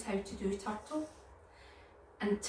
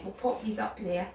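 plain reading voice

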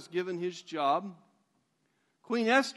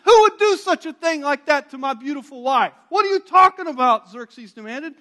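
high, loud outraged demand; a furious king, high and loud; 8 semitones higher, 13 dB louder than usual. Retold or imitated. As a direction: roar your outrage at the offender. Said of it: "The king is furious".

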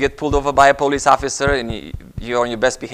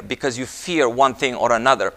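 normal talking voice

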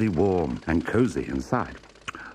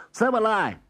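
high, eager little pig; a squeaky second piglet; 4 dB louder than usual. A narrator's reading. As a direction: pipe up like a tiny pig. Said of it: "said the second".

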